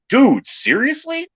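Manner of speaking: very high, slow incredulous whine; exasperated disbelief. Retold or imitated. Magician to kids popping balloons, in high slow exasperation. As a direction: stretch out the disbelief. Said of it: "you're like".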